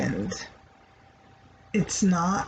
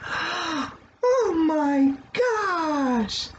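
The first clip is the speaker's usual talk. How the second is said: mock surprise; 6 semitones higher, much slower than usual. Shown as a demonstration. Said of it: "a part that you might say".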